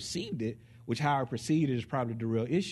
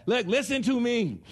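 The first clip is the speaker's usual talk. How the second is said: higher, sharper mock husband; mock husband snapping; 10 semitones higher, 4 dB louder than usual. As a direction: play the irritated husband telling her off. Husband imitated snapping at wife.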